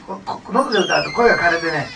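ordinary talk, nothing marked

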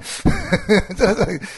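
rueful laughing aside. Real, not acted. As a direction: laugh it off while you admit the point. Laughing at Kyushu's missed quota.

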